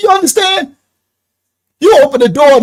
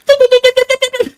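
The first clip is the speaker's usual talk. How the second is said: high tee-de-de bird chatter; mock bird squawking; bird chatter imitation; 14 semitones higher than usual. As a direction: chirp like an angry little bird.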